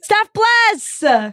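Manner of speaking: high, thrilled name call; excited shout-out. Real, not acted. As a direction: cheer the name out. Excited echo of friend's name.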